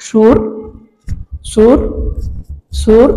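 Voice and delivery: সুর said slowly, syllable by syllable, with pauses; teacher breaking সুর into its syllables; sounding out syllables. Shown as a demonstration. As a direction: spell out সুর by its sounds, one beat each. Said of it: "উচ্চারণ করে দেখো".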